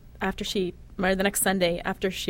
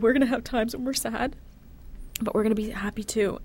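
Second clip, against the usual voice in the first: higher, tender soothing voice; comforting; 4 semitones higher than usual. Retold or imitated. Mother comforting her dying daughter, tender and higher. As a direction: soothe a frightened child.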